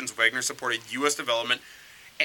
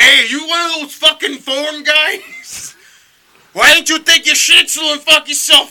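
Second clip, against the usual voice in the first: much higher, much louder, slow mock yelling at a foreigner; hammed-up foreign-hating thug; 12 semitones higher, 14 dB louder than usual. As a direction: bellow it like a mock bigoted thug. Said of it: "angry emails for that voice".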